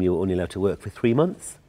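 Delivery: usual speaking voice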